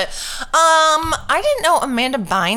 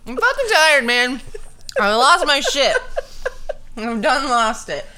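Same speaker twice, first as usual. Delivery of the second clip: cracking up from exhaustion; giddy laughing; 8 semitones higher than usual. Real, not acted.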